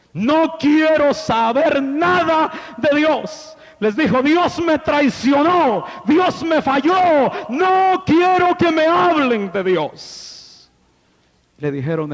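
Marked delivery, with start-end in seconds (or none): 0.1-10.7 high, loud, slow cry of betrayal; bitter shout. Retold or imitated. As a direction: hurl each word out in grief. Grieving man rejects God.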